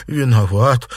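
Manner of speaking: exclaimed apology. A narrator's reading. Servant bowing to his mistress, exclaimed apology. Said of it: "воскликнул Синер".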